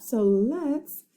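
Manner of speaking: ordinary delivery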